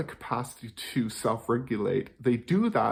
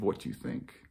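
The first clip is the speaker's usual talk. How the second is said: near-whisper, much softer; quiet whisper; 9 dB quieter than usual. Shown as a demonstration. Coach showing the question to avoid, whispered. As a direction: let it fade to a hushed aside. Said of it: "instead of".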